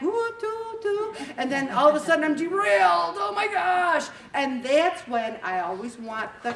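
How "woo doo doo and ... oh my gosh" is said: high, loud whoop into "derailed"; derailing train whoop. Pretend. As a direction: whoop like a train jumping the track.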